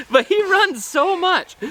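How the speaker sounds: very high, slow, drawn-out mom voice; hammed-up high mom impression; imitating mom. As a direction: squeal it slowly as an impressed mom. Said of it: "My mom, that was one thing she said".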